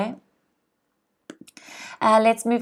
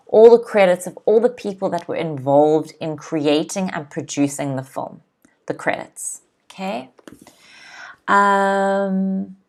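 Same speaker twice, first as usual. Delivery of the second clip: ordinary delivery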